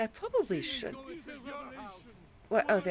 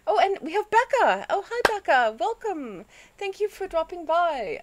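bright, high, louder welcome; cheerful greeting; 9 semitones higher, 8 dB louder than usual. Real, not acted. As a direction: greet the viewer warmly. Greeting a new viewer.

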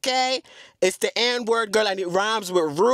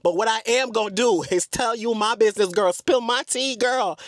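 normal talking voice

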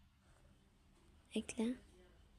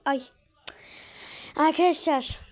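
surprised; 4 semitones higher, 7 dB louder than usual. Real, not acted. Sudden exclamation after a slip.

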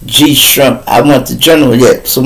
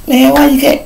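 put-on whiny girlfriend; 13 semitones higher than usual. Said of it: "Bitch ask me".

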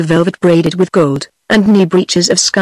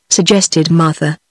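ordinary delivery

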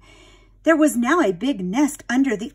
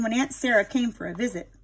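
usual speaking voice

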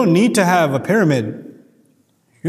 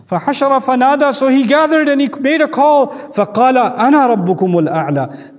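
higher, louder chanted verse; Quran recitation; 6 semitones higher, 4 dB louder, much faster than usual. Acted.

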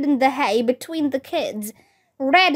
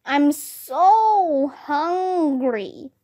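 slow, drawn-out monster moan; long, slow wail of a hungry monster; calling out; much slower than usual. A narrator's reading. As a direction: stretch each word out like a hungry ghoul. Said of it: "and called out".

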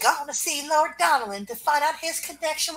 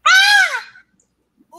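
scream; 11 dB louder than usual. Acted. Girl on stage is shot.

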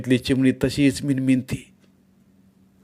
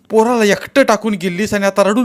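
higher, louder irritated reproach; mildly angry; 10 semitones higher, 7 dB louder than usual. Acted. Sakha rebukes Shevanta for leaving the boy, raised annoyed voice. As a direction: scold her with a bite of anger. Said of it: "जरासं चिडूनच बोलला त्यो".

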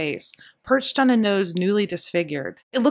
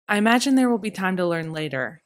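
plain reading voice

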